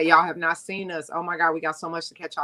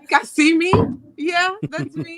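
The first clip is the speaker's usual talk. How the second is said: calling out loud and high to the crew; pitched-up shout of a question; shouting a question to friends on the call, loud and high; loud mock call; 9 semitones higher, 6 dB louder than usual. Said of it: "so I was like".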